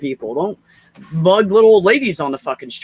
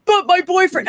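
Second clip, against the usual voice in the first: much higher, pleading whine; whiny plea; 11 semitones higher, 3 dB louder than usual. Retold or imitated. Rhonda begs the store clerk, high and whiny. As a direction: mimic her helpless whine.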